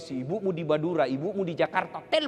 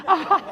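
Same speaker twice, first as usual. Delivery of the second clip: mock pained cries; 13 semitones higher, 9 dB louder than usual.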